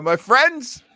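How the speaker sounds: usual speaking voice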